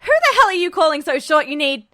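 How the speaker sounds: loud, fast, indignant shout; furious outburst. A narrator's reading. Edward yelling at Mustang over a short joke, furious. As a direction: explode at the insult, fast and loud. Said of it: "Edward was on his feet".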